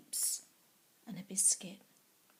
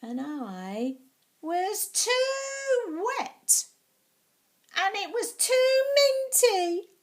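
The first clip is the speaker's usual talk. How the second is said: high, loud, slow whine; whiny complaining; 10 semitones higher, 7 dB louder, slower than usual. Acted. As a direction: stretch out every "too" in protest. Child complaining at bathtime.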